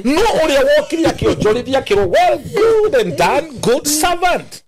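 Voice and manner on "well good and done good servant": high, drawn-out words of praise; slow, high-pitched proclamation; master's praise. Acted. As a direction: voice a master commending a servant, slowly. Voices master praising the servant.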